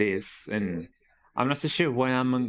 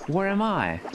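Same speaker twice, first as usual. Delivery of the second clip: "Where am I?" pitched up in a British accent, falling on "I"; British inflection; 8 semitones higher than usual. Shown as a demonstration. Streamer trying the British accent on "Where am I?". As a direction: ask "Where am I?" the British way, dropping on "I". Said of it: "even the inflection has to change".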